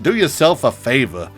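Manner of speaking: ordinary talk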